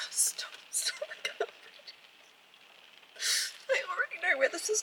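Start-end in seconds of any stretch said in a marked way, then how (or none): 0.1-1.9 whispered, overwhelmed pleading. Real, not acted. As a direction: breathe it, barely voiced. Overwhelmed by sad chapter.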